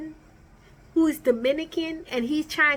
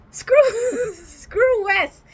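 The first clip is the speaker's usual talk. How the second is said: laughing; 7 semitones higher, 4 dB louder, much slower than usual. Real, not acted. Laughing while mocking a cast member.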